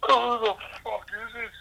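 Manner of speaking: high mock voice. Asking about the poster in a squeaky imitating voice. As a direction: squeak it up high like a baffled mate. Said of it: "He goes".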